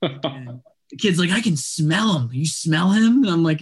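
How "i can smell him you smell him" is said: put-on higher-pitched, eager kid voice; imitated excited kid. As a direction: lightly mimic an excited child. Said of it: "he's, like, all excited".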